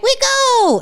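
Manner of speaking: high, eager shout of go; mock daring cheer; eager hype. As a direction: jump in with reckless glee. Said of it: "I'd be like".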